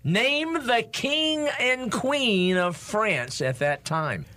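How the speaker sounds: slow, drawn-out quiz host; game show announcer. Acted. Announcing the trivia question.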